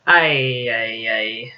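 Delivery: weary grumbling groan of a man. Acted.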